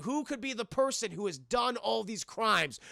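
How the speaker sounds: ordinary talk, nothing marked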